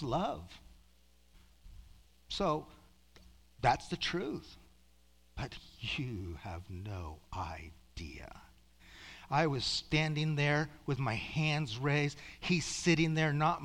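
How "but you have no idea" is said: slow, low, hushed whisper; awed whisper. Real, not acted. Preacher telling listeners of God's love, awed slow whisper. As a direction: whisper it slowly in wonder.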